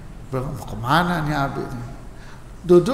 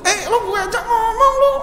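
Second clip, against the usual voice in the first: loud, high-pitched Betawi hollering; brash Betawi; 5 dB louder than usual. Acted. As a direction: call them out like a brash Betawi. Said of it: "Orang Betawi kan juga begitu". (gaya Betawi ceplas-ceplos, lantang)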